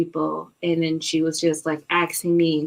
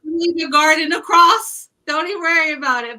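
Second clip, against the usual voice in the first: high, loud, fast mock host mom; bossy host mom brushing it off; 10 semitones higher, 7 dB louder than usual. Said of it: "host mom quote continues".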